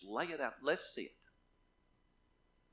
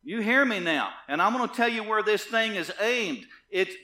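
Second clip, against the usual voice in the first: building to a loud, raised pulpit cry; forceful preaching; 5 semitones higher, 11 dB louder than usual. Real, not acted. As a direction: warn the congregation with rising force.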